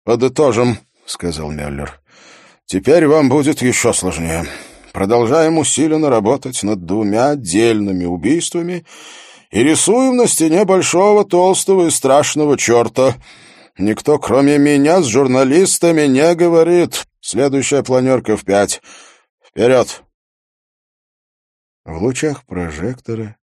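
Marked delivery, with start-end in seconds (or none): 0.0-0.8 loud, higher-pitched briefing voice; commanding police chief. Acted. Police chief briefing his officers, loud and commanding. 2.7-20.0 loud, high-pitched rallying orders; commanding police chief. Acted. Police chief sending his team back to work, loud and urgent. Müller closes the briefing with orders.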